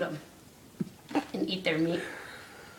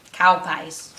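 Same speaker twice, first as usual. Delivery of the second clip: ordinary delivery, nothing marked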